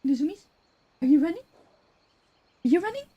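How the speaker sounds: high, soft cooing at the cat. Real, not acted. Streamer asks her cat about running, high soft baby talk. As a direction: coo the question up high like to a kitten.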